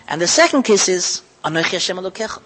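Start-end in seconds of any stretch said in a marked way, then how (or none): none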